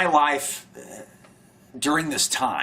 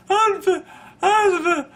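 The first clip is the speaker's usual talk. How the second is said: broken off through laughter; laughing; 6 dB louder than usual. Real, not acted. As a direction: try to say it, giggling. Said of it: "without laughing".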